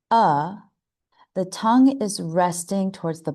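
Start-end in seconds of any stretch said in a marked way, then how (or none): 0.1-0.6 "uh" as a relaxed, neutral vowel; schwa sound. Shown as a demonstration. Teacher showing the second sound of A, schwa sound. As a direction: say "uh" from the throat with a loose jaw. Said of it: "For the schwa sound".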